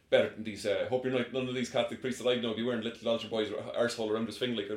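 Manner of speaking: put-on Irish accent, rambling and muddled; weird Irish accent. Quoting the Irish vampire's baffling line, weird Irish accent. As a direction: garble it in an odd Irish accent. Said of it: "he just says something in a really weird accent".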